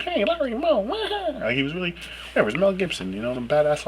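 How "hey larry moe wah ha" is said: very high, goofy slapstick yelp; Three Stooges impression. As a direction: squeal it like a Stooges bit. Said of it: "he had a really good".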